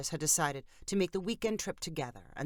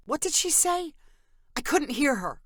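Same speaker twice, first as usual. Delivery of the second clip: much higher, louder panicked cry; anxious cry; 10 semitones higher, 3 dB louder than usual. A narrator's reading. Amber driving in rain cries out to Candace, panicked and loud. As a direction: call out over the noise, alarmed. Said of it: "cried Amber".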